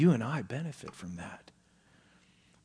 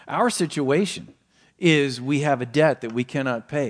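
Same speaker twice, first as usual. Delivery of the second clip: usual speaking voice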